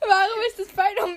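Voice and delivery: high, laughing till near tears. Real, not acted. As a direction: read it while cracking up. Reading through laughter.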